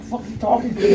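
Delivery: high-pitched mock indignation; retold anger. Ex-prisoner snapping back at a talking store machine, mock mad. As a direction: act out being mad, half laughing. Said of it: "I'm getting mad".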